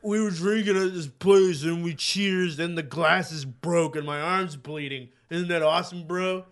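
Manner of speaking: put-on voice of a boozy bro; imitated drunken bro. Man imitating a bar-goer on the train, mock bro voice. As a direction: play an oblivious party guy bragging. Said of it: "some guy's like".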